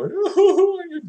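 very high, loud mock laughing hoot; hammed-up laughing. Acting out how laughter makes you feel good, hammed-up. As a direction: act out helpless, happy laughter.

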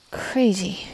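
ordinary talk

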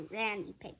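ordinary delivery

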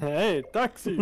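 slow, drawn-out taxi call; hailing a taxi. Pretend. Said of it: "I really like the taxi one".

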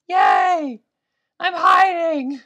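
high, delighted squeal, stretched out; excited squeal. Real, not acted. Gamer squealing to viewers about her hiding spot.

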